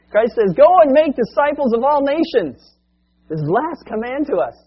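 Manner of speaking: loud, high proclamation; preaching at full force. Acted. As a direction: proclaim the command to the church. Preacher quotes Christ's command.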